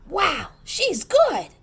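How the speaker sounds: high, slow, wide-eyed wow; amazed children. A narrator's reading. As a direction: gasp it out in awe like the kids. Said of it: "All the children thought".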